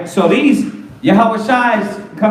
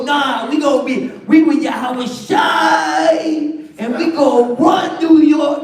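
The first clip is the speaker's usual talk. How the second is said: hammed-up New York accent, drawn out on the name; bad New York accent; 5 semitones higher than usual. Mocking New York cats to the congregation, bad New York accent. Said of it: "my bad New York accent".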